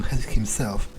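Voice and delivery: ordinary talk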